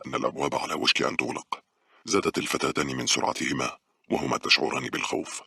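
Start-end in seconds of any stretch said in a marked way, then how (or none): none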